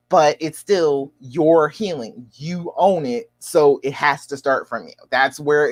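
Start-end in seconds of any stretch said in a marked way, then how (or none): none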